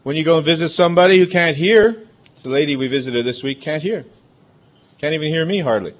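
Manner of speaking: ordinary talk, nothing marked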